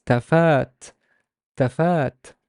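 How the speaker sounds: tafat with a lengthened second a, falling; teacher modelling a slow, drawn-out tafat; long vowel a. Shown as a demonstration. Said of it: "so it's a long vowel a".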